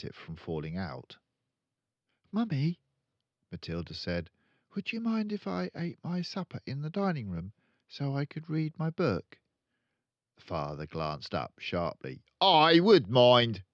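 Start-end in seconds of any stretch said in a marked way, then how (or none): 2.3-2.8 put-on high, earnest child; a small girl protesting; little girl voice. Matilda scolding her father, high and earnest. 4.7-9.4 put-on high, polite child; polite little girl. Matilda asking mother, high and polite. 12.4-13.6 loud, slow, sharp snap; snapped retort. Acted. Father snapping at Matilda, loud. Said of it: "he snapped".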